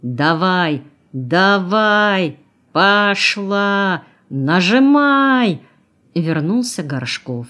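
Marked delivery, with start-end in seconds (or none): 0.0-5.6 building shouts, higher and louder, last word drawn out; drivers shouting. A narrator's reading. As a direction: yell orders over roaring motors, building to a long final cry. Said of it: "слышались голоса водителей".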